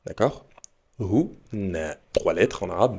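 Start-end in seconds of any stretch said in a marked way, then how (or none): 1.0-2.0 HU and NA split apart; syllable by syllable. Shown as a demonstration. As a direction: break the word in two. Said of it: "mais deux syllabes". (syllabe par syllabe)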